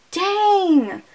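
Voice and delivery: a high, falling "Dang!" of amazement; high exclamation of awe; amazed. Real, not acted. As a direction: react like you just saw a great hit. Reacting to a brutal fight scene.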